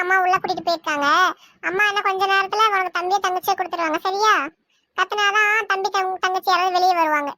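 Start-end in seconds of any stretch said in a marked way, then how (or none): none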